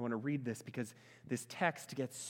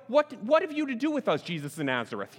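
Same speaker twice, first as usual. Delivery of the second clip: loud, high burst falling away at the end; challenge, not question; 6 semitones higher, 8 dB louder than usual. Retold or imitated. Preacher re-voices unclean spirit to congregation, loud challenge. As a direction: throw it out as a challenge, falling tune. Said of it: "that wasn't a question".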